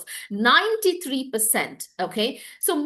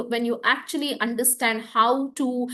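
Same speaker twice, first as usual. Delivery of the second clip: ordinary talk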